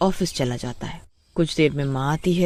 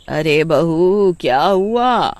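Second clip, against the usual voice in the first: drawn-out louder call to the bahu; mother calling after her bahu, louder and slow; concerned call; 6 dB louder, much slower than usual. Acted. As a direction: call out slowly, worried. Mother calls after daughter-in-law.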